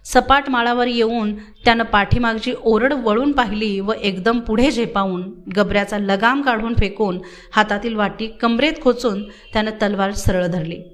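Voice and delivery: ordinary delivery, nothing marked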